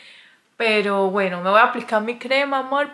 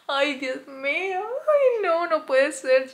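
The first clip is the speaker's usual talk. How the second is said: nervous dismay; 6 semitones higher, slower than usual. Real, not acted. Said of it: "porque estoy nerviosa".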